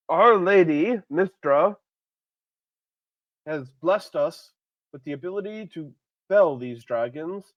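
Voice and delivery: loud, slow, ringing oration; rally the crowd like a champion; a hero rallying the townsfolk, loud and slow; heroic speech. Acted.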